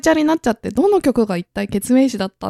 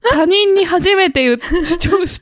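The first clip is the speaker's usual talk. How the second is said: high voice; 7 semitones higher, slower than usual. Shown as a demonstration. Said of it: "ずっとこのままいけんだよ".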